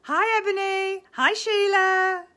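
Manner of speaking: high, loud sing-song hellos; loud cheerful hi; cheery greeting. Real, not acted. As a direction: call out hellos to viewers. Greeting viewers on live.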